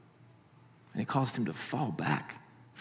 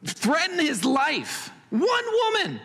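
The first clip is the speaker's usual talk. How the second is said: much higher, slow, squeaky disbelief; incredulous mock squeal; 5 dB louder, slower than usual. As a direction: squeal it like the idea is absurd. Mocking Elijah's fear of Jezebel.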